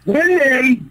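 high, drawn-out name call; calling to attention. Acted. Interrupting a quarrel by name, high call.

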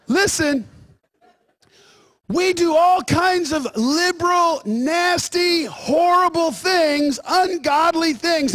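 high, emphatic preacher's attack; forceful preaching. Real, not acted. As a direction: preach it hard, drawing out the key words.

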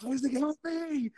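high, slow, strained plea for help; mock cry for help. Arm caught in the elevator doors, begging a friend, high pleading cry. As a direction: cry out to your friend like you're trapped.

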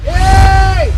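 loud sharp call; angry shout. Acted. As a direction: bark it at him. Angry landlord calls out.

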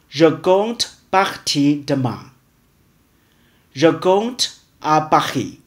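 ordinary delivery

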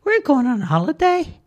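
very high, tiny cartoon voice; small squeaky character voice; squeaky Charlie voice. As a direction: pitch it way up like a little creature. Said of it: "Charlie replied".

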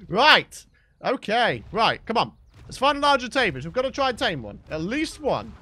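usual speaking voice

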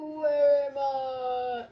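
drawn-out wail. Acted. Gamer lost on the map, wailing the question slowly and loudly.

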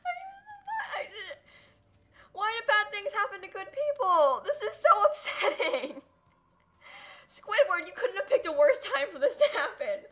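high, loud, teary wail broken by laughs; distraught half-crying. Real, not acted. Streamer lamenting her dead dog to Squidward, teary wail.

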